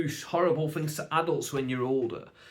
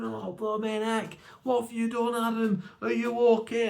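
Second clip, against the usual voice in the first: high-pitched mock motherly alarm; flustered mum; 9 semitones higher than usual. Mum checking on her kid after the mishap, high worried voice. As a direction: imitate a mum fretting over her child. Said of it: "Mum's going".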